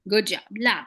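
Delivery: ordinary talk, nothing marked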